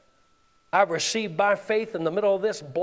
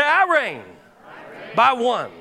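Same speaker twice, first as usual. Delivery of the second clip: raised, emphatic declaration; louder call; 3 dB louder, slower than usual. Shown as a demonstration. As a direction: proclaim it out loud.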